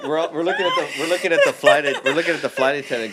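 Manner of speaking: can barely get the words out for laughing. Real, not acted. Telling friends about the flight attendant, laughing.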